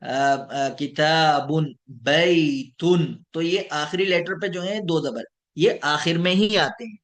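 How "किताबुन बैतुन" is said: किताबुन and बैतुन very slow, -un stressed; tanween demo. Shown as a demonstration. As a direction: draw out बैतुन, landing on un. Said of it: "इसको हम तनवीन बोलते हैं".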